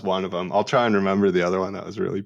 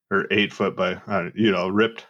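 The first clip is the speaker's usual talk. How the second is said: ordinary delivery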